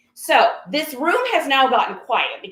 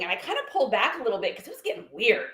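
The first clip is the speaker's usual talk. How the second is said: ordinary talk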